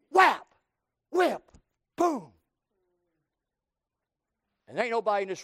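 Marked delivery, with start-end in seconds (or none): 0.1-2.3 loud punchy whap whip boom; mock whipping sounds. Preacher imitating his father's belt blows, loud. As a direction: spit out each smack sound. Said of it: "All I heard was".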